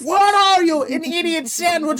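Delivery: high, very loud mock outrage; Gordon Ramsay. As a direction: berate a cook like an enraged TV chef. Acting out Gordon Ramsay's insults.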